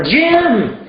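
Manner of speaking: high, eager shouted name; shouted guess. Pretend. Student guessing the boy's name, shouted high. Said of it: "so you guess".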